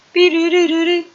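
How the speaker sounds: high rippling be-doo-doo; sung, high-pitched run of doo-doos; vocal sound effect. Shown as a demonstration. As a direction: trill out a high game noise. Imitates a game saw's movement.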